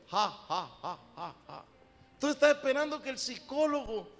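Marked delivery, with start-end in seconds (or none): 0.1-1.7 fake laugh. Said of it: "que me da risa".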